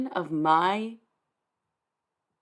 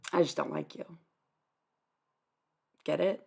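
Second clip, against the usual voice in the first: ordinary delivery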